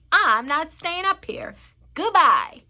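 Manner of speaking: loud, huffy farewell; loud refusal. A narrator's reading. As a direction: refuse outright and bail out. Centipede flees to the tunnel.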